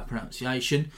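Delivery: ordinary talk, nothing marked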